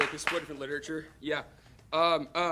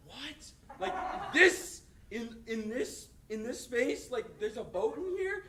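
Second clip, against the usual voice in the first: retold amazement; 5 semitones higher, slower than usual. Retold amazement at seeing a boat.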